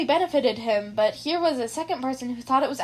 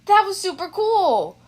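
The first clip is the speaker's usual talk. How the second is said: higher, louder, slow admiring praise; impressed; 7 semitones higher, 5 dB louder, slower than usual. Acted. Kirishima impressed by Izuku's quirk analysis, high, loud and slow. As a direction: savour how cool it was.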